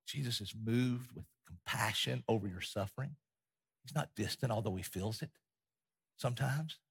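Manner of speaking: soft, low, measured comfort; tender reassurance. Real, not acted. Preacher consoling those who are suffering, softly tender.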